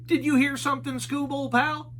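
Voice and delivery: high goofy Shaggy voice. A narrator's reading. Shaggy asking Scooby about a noise, high and goofy. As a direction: ask like a nervous cartoon slacker.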